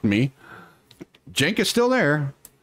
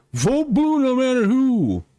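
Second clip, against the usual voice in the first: mock slogan; 12 semitones higher than usual. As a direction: chant the slogan like a smug loyalist. Mocking Democratic loyalists' slogan.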